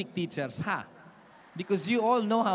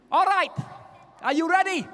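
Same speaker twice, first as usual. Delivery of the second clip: high, loud rallying call to a crowd; 11 semitones higher, 4 dB louder than usual. Real, not acted. Singer asking the audience if they are ready, calling out. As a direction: pitch it up high and rouse the audience.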